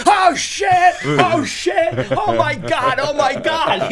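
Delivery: shocked shout. Retold or imitated. Heckler stunned the monster obeyed, loud shocked shout. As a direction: cry out in amazed disbelief. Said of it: "he goes".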